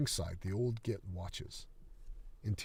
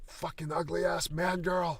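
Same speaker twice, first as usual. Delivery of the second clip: higher, slow yell from far away; yelled off screen; 8 semitones higher, slower than usual. Acted. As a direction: bellow it after her. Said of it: "Old git, off screen".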